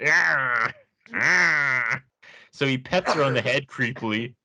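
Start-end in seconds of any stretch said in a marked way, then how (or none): 0.1-2.0 drawn-out comic "aah", pitched up; silly imitated groan, twice over; imitated noise. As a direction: mimic a creepy aroused groan. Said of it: "that's the sound it makes".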